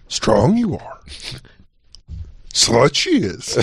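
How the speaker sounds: mock Jedi master with rising-falling swoops; slow, halting pronouncements with a swoop mid-phrase; Yoda. Co-host parodies the little Jedi master, wise pronouncement. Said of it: "the same face that Yoda made".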